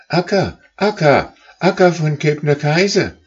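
very high, loud calls; calling out. Acted. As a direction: cry out for the leader again. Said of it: "riefen von neuem".

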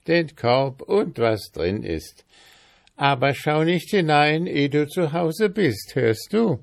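high, insistent warning; warning old woman. A narrator's reading. Old woman warns boy not to look, insistent high voice.

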